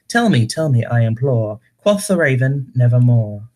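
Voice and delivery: poem recited in a put-on British accent; theatrical British reading of a poem. Shown as a demonstration. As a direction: declaim gothic verse in a fake British accent, pitch swooping. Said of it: "I'll just read a scene with a British accent".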